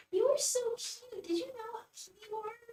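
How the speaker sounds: high, doting coo; pet talk. Real, not acted. Cooing over the cat.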